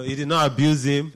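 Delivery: ordinary talk, nothing marked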